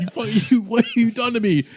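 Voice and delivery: high, laughing mock accusation; hammed-up betrayal; mock outrage. Sokolov accusing Arthur with laughing mock outrage. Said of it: "And Sokolov's like".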